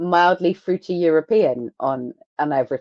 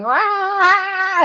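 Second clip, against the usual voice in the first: long high-pitched "rah" wail; mock screeching. Mocking Lestat's singing to the band, mock screech. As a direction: imitate a bad singer's screech.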